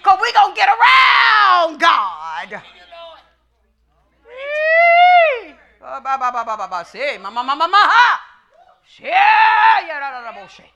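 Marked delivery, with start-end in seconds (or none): 4.3-10.7 babbling demonic voices; ba ba ma ma babble, possessed; floppy jaw ya ya drill. Acted. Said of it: "You can hear it in the demonic voices".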